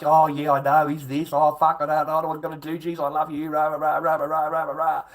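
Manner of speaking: mock the besotted guy gushing over her; mock lovesick simp. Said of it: "you're like".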